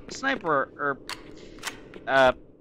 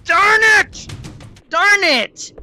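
high loud frustrated yells; frustrated shouting; 13 semitones higher, 6 dB louder, slower than usual. Real, not acted. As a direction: shout in sudden defeat. Killed by enemy, shouting.

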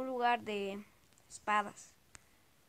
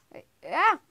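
grito de susto; 7 semitones higher, 14 dB louder than usual. Real, not acted. Susto al ver algo en el juego.

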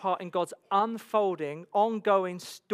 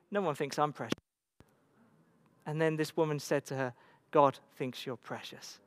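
tender quote; 6 semitones lower, 6 dB quieter than usual. Retold or imitated. Tender retold moment.

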